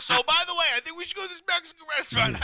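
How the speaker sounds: high, fast, chirpy imitation of his wife; mock wife voice. Husband on the toilet, wife chatting on her phone: high mock wife voice. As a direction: breezily pitch dinner plans in a high put-on voice.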